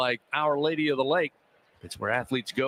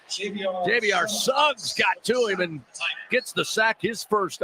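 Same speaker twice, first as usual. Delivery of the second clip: excited call; 6 semitones higher, slower than usual. Real, not acted. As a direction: stretch out the sack call. Crediting the sack, still excited.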